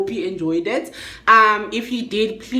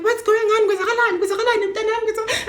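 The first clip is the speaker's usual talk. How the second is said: concerned ladies; 12 semitones higher, much faster than usual. Retold or imitated. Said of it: "the three ladies are like".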